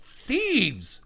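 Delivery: high, loud outraged king voice; king finding his stolen clothes cries out. Acted. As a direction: cry thieves as the furious king. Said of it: "the king cries out".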